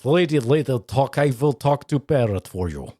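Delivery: Eastern Bloc pet store owner, Ukrainian accent. Shown as a demonstration. Pet store owner reassuring a lady, Ukrainian accent. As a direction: play it with a thick Ukrainian accent. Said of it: "someone from the Ukraine or that".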